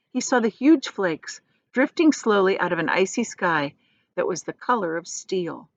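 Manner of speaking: plain reading voice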